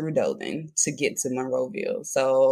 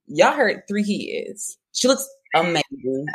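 amazed; 3 semitones higher, slower than usual. Real, not acted. Host telling viewers the guest looks too good for three kids, amazed. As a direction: let the disbelief stretch out.

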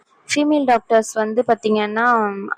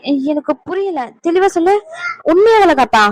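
higher, agitated disbelief; incredulous demand; 6 semitones higher, 3 dB louder than usual. Acted. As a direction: press for the truth, getting louder. Demands clarity about the shocking news.

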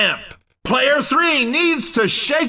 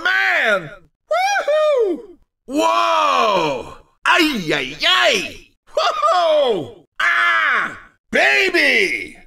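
big drawn-out announcer reactions; hammed-up commentator cries; excited exclamations; 4 semitones higher, much slower than usual. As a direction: whoop and holler at the crashes. Commentator reacts to race action.

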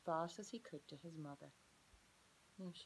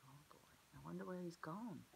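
usual speaking voice

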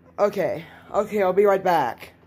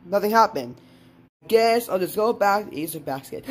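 ordinary talk, nothing marked

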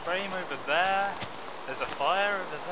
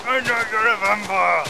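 accusing shout; 5 semitones higher, 10 dB louder than usual. Retold or imitated. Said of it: "It's like".

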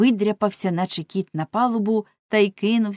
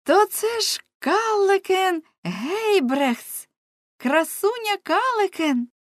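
much higher, slow astonished cry; surprised exclamation; 12 semitones higher, 3 dB louder, slower than usual. Acted. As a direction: gasp out her name in amazement. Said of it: "Нелі вигукнула". (здивований вигук)

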